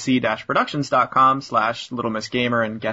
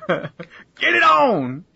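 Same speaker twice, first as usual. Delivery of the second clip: excited; 8 semitones higher, much slower than usual. Real, not acted. Excited echo with laugh.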